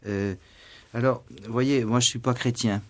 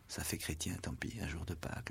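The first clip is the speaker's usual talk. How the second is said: a hushed self-mocking aside; whispered aside; 3 semitones lower, 14 dB quieter than usual. Real, not acted. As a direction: whisper it as a confession.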